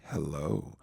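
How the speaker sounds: low, soft suave hello; Thai Billy D voice. Retold or imitated. Imitating the admirer answering Belinda, suave. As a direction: drop it low and soft, imitating a smooth suitor. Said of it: "Thai Billy D voice of his".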